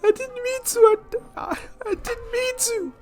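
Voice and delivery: very high, softening whimper; small, crying kid voice; tearful apology. Acted. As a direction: stammer it through acted sobs. Traumatized boy tearfully apologizes.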